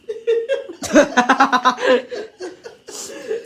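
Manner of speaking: high, loud burst of laughter; real laugh breaking out. Real, not acted. Narrator laughs at the story.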